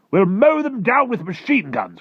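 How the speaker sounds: slow, very high, loud shout; fierce military cry. Acted. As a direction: cry out for battle as an army chief. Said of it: "cried the head of the army".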